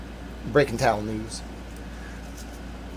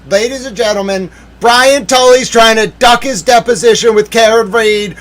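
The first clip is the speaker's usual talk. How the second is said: raised, theatrical breaking-news proclamation; breathless-news announcer; 6 semitones higher, 6 dB louder than usual. Pretend. Announcing towel news to the viewers, mock breaking-news fanfare. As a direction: proclaim it like a big scoop.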